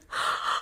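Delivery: sharp gasp of terror; horrified gasp. Acted. Mary gasps in horror at her realisation. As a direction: gasp as the truth hits.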